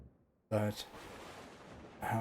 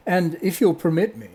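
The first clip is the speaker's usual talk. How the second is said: high, loud, courteous address; grand hostess addressing everybody; fairy godmother; 8 semitones higher, 8 dB louder than usual. Acted. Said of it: "she's saying this to everybody".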